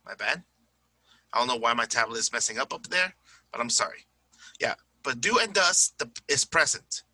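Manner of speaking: normal talking voice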